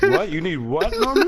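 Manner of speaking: shocked, call him out in disbelief; incredulous. Real, not acted.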